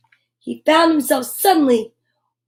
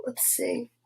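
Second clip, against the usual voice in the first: whispered aside; 14 dB quieter than usual. Real, not acted. Browsing books under her breath.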